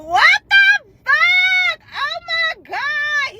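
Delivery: high, drawn-out shriek of disbelief; retold horrified shock. Learning her video was sent to the other boy, high drawn-out horror. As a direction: stretch every word way up high.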